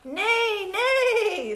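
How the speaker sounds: loud, high storybook neigh; long, high horse call. Acted. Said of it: "said the horse".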